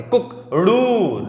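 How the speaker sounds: slow drawn कुक, ड़ू at double length; double-length rooster call. Shown as a demonstration. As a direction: stretch the ड़ू to twice the short sound. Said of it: "double, इससे double ध्वनि".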